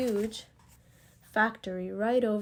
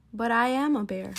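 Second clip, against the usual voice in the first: louder, insistent stress on "am"; insistent protest; 6 dB louder than usual. Acted. Bear protests to the disbelieving foreman, insistent. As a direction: protest firmly, hitting "am".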